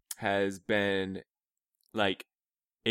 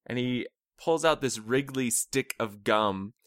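normal talking voice

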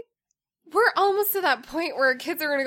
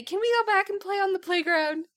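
high-pitched imitation of eager kids; pleading kids; 8 semitones higher than usual. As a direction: mimic children begging, pitched up. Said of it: "just for them to say".

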